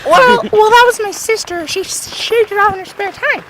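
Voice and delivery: put-on high-pitched kid making excuses; defensive kid. Cousin blames his sister, in a high defensive kid voice. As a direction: put on a high little-boy voice.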